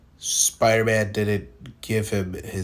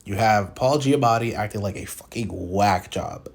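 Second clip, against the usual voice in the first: ordinary delivery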